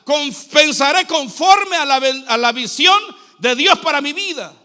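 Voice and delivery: emphatic vows in measured, projected phrases; preached declaration. Real, not acted. Preacher declaring a new way of thinking, forceful preaching. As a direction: declare it firmly from the pulpit.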